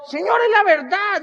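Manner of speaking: high, loud, drawn-out protest; exaggerated insistence. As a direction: plead innocence, stretching the words. Defends his absurd excuse to mother. (insistencia exagerada, aguda y alargada)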